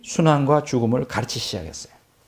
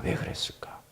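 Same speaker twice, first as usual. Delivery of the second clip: voiceless, very soft whisper; hushed rhetorical echo; whispered question; 17 dB quieter than usual. Real, not acted.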